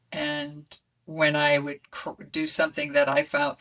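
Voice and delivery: usual speaking voice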